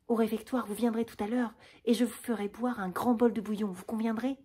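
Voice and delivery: usual speaking voice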